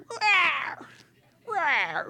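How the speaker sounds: high squeaky lion cub roar; a little cub trying to roar. Retold or imitated. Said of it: "Simba's going".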